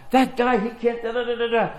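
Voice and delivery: high-pitched mock rant; mock angry yelling. Rapper keeps complaining about the other guy, mock angry. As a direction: imitate someone ranting past the listener. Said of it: "fighting and yelling and screaming and cussing".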